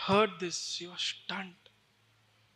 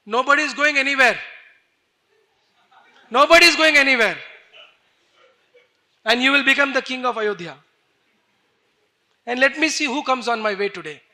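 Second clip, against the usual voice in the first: loud, raised-pitch defiant declaration; furious outburst; 5 semitones higher, 12 dB louder than usual. Acted. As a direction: shout it, higher and fast. Enacting Lakshman's furious outburst in story.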